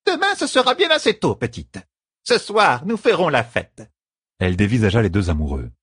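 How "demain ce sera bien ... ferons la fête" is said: very high scolding character voice; stern bossy servant. A narrator's reading. Servant ordering the lovers to marry, stern. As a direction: order them about, pitched way up.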